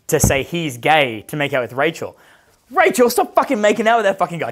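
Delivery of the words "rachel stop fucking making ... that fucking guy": higher, calling across the club; mock yelling. Calling Rachel away from the guy, a higher mock yell. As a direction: yell across the room to pull her back.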